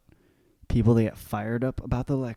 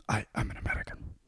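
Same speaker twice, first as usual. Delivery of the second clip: whispered ad line; 11 dB quieter, faster than usual. Retold or imitated. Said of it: "the I'm American commercial".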